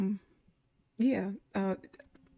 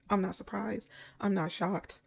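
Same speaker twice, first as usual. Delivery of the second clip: normal talking voice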